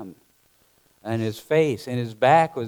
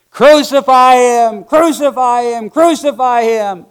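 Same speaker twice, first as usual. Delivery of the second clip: louder, higher mob chant; mob yelling; 11 semitones higher, 12 dB louder than usual. Acted. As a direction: bellow it like a mob calling for death. Mob crying for crucifixion.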